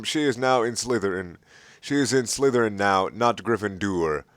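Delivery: ordinary delivery, nothing marked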